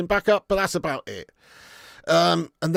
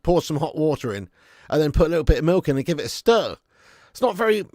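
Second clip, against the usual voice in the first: normal talking voice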